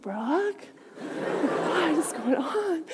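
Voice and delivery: very soft, slow, drawn-out question; stunned neighbor. Retold or imitated. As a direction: imitate a dumbfounded neighbor, hushed and stretched. Neighbor's stunned reaction, acted out.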